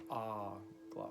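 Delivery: Ah Gla slowly, "AAH-gla"; stress on first syllable. Shown as a demonstration. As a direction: draw out the opening Ah of Ah Gla. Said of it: "with the emphasis at the beginning".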